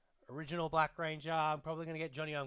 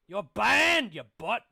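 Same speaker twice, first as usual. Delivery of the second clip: loud, high shout at the bot; angry shout; 7 semitones higher, 15 dB louder, slower than usual. Real, not acted. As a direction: yell the ban at it. Banning a spam bot.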